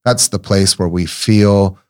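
ordinary delivery

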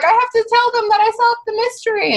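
put-on high, urgent childish plea; eager Harry. Harry pleading to see friends, high childish urgency. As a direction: beg like a kid who cracked the case. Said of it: "And Harry's like".

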